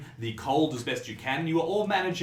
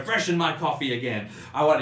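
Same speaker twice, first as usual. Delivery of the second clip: mildly raised, frustrated demand; irritated customer's complaint, pitched up; complaining, getting louder; 5 semitones higher, 5 dB louder than usual. Acted. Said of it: "he's getting louder and louder".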